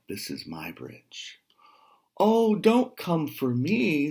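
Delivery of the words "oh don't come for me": high, slow little goat voice; small goat pleading. A narrator's reading. Little goat begging the troll not to eat him: high pleading voice. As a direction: plead like the tiniest goat on the bridge. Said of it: "said the first Billy Goat Gruff".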